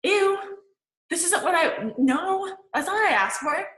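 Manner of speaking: high, disgusted whine of someone not getting what they asked for. Retold or imitated. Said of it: "and we're like".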